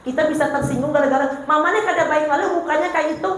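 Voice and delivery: ordinary talk, nothing marked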